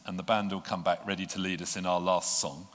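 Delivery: ordinary talk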